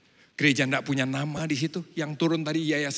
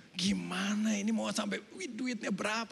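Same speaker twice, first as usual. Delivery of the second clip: whispered thought; 5 semitones higher, 8 dB quieter than usual. Retold or imitated. Retold worried private thought.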